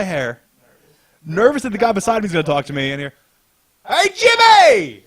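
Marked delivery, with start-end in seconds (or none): 3.9-5.0 high, loud, slow drawn-out yell; imitated shout of a buddy; mock scream. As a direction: holler the name across the locker room. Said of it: "Fucking screaming".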